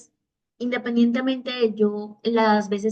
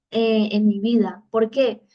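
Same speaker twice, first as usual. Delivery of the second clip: ordinary talk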